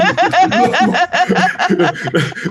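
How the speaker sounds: high, loud burst of laughter; big laugh. Real, not acted. Laughing at his own hospital story, big laugh.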